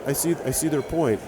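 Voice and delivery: normal talking voice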